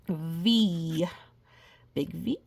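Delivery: ordinary delivery, nothing marked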